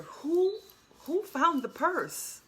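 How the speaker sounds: high, eager asking after the purse; urgent question. Retold or imitated. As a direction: ask who found it, breathless with hope. Anxious question to store lady.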